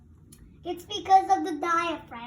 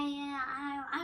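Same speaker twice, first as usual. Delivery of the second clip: normal talking voice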